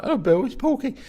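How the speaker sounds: put-on the young comic, keen as anything; high eager hello. Young comic greeting his hero in a high eager voice.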